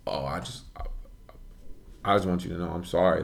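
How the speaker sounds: hushed, hesitant mock apology; halting apology. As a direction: drop your voice and stumble into saying sorry. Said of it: "he stops, pauses".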